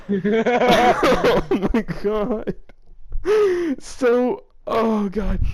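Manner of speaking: slow, high, wheezing oh my god through laughter; laughing. Real, not acted. To a friend after a ridiculous line, laughing hard. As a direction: drag it out while still laughing.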